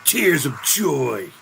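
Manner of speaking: slow, drawn-out film quote; quoted movie character. Retold or imitated. Streamer repeating a film character's line, slowly acted out. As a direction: savour the quoted line, stretching each word.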